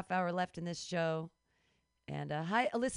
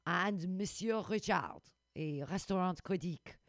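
put-on French accent; 3 semitones lower than usual. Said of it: "I learned how to do a French accent".

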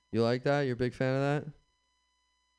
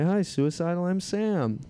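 much higher, cheery hotline greeting; chirpy hotline worker; 7 semitones higher, slower than usual. Retold or imitated. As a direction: keep it light and chipper. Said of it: "my impression of a ... suicide hotline worker".

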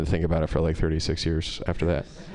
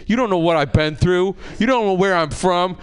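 high, indignant imitated yell; mock your friend's outraged hollering; Luke screaming; 10 semitones higher, 4 dB louder than usual. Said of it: "we're both screaming at this woman".

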